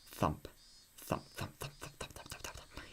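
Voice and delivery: mouthed thumps fading to a whisper; knock-knock sounds dying away to nothing. Retold or imitated. Gamer voicing the passcode knocks, fading to whisper.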